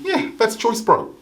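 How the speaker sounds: ordinary talk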